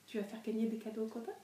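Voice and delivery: soft, fast question to a dog; talking to a dog. Real, not acted. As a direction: ask the dog playfully, softly. Asking the dog.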